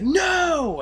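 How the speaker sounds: high, loud drawn-out no; horrified scream. Retold or imitated. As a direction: imitate a desperate yell to stop. Retold horrified cry before shooting.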